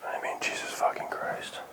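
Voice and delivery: shocked whisper. Real, not acted. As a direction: whisper it under your breath. Spooked in basement.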